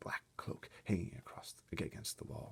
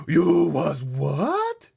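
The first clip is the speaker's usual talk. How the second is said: high, loud, very slow shocked cry; 11 semitones higher, 15 dB louder, much slower than usual. Acted. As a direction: stretch out the disbelief. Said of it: "cried the B F G".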